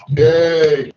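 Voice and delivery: high, drawn-out cheer; yelling. Real, not acted. Host cheering after the speaker's talk, yelling. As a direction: let out a long, high yay. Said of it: "that was me yelling".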